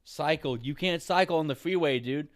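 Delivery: loud, heated retort to a dumb idea; exasperated yelling. Real, not acted. Rejecting cycling on the freeway, exasperated shout. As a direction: shoot it down, shouting. Angrily rejecting imagined suggestions.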